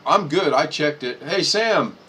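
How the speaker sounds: ordinary delivery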